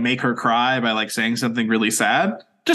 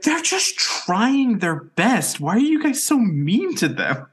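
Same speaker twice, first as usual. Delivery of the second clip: higher, slow, pleading voice; pleading girlfriend; 9 semitones higher, slower than usual. Retold or imitated. Girlfriend scolding booing fans, higher and slow. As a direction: plead gently for the other team. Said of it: "she would just be like".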